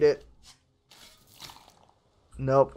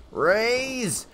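high, drawn-out mock holler; sailor calling orders; 7 semitones higher, much slower than usual. Crew member asking the captain to raise, playful high holler. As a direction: draw the words out high, like a deckhand.

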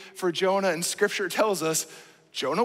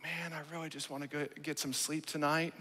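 tired excuse; 6 semitones lower, 12 dB quieter than usual. Retold or imitated. Tired excuse acted as himself.

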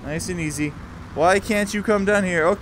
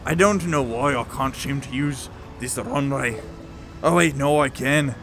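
lower, slurred mock Scottish accent; drunk Sean Connery; 4 semitones lower than usual. Player fumbling with a runway, drunken low brogue accent. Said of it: "I sound like a drunk Sean Connery".

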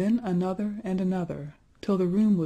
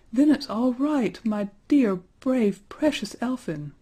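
high, loud, impassioned outburst; joyful cry; 6 semitones higher, 5 dB louder than usual. A narrator's reading. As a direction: cry it out with loving relief. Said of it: "she cried".

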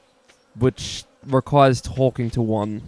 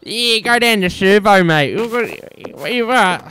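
hammed-up broad Australian ocker; 8 semitones higher than usual. Mate tells the viewers to head to the servo, broad Australian. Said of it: "I was Australian".

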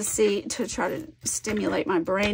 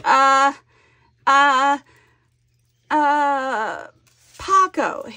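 loud, halting stabs at an answer; re-enacted groping guess; 4 semitones higher, 6 dB louder, much slower than usual. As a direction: act out searching for a name, loudly. Acting out her hesitant guessing.

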